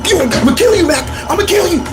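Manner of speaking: high-pitched mock scream; screaming and struggling. Boy pulled into mom's car threatens bully, acted high screaming. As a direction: squeal threats as if being dragged off, hammed-up. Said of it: "I'm screaming and kicking on the way".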